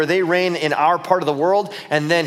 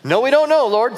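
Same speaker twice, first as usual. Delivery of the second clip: high, loud protest; acted high-pitched exasperation; exasperated protest; 7 semitones higher, 5 dB louder than usual. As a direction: object loudly, voice pitched right up. Thomas protests to Jesus.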